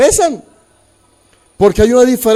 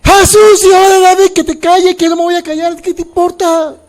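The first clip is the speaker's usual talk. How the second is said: shouting over the crowd; 9 semitones higher, 4 dB louder than usual. Acted. Bartimeo shouting past the crowd.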